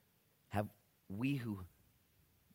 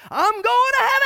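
full-throated joyful shout, much higher; yelled out; 20 dB louder than usual. Retold or imitated. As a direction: yell it out while riding past on a bike. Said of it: "He just yelled out as he drove by".

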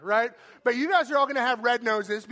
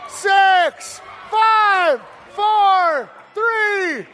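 loud, higher countdown, each number punched; yelling the last seconds over the cheering room; countdown shout; 6 semitones higher, 7 dB louder, much slower than usual. Real, not acted. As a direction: count them down to zero at full volume. Host counting down the final seconds.

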